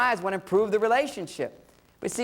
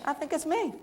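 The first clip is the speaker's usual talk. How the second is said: high, lightly voiced wife; mock wife pleased; 9 semitones higher, 5 dB quieter than usual. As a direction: play the wife admiring herself. Wife trying on outfit.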